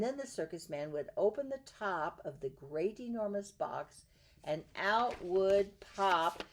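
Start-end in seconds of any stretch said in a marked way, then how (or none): 4.4-6.4 slow, loud and high triumphant announcement; big reveal. A narrator's reading. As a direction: draw it out for the surprise.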